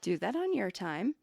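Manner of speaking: firm but calm, pulled way down in volume; calm quiet reminder. Retold or imitated. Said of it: "He tells her".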